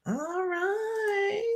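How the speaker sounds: sing-song high all right, held out; high drawn-out all right. Acted. Host echoing herself to the viewer, high and drawn out. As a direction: stretch it way up high and long.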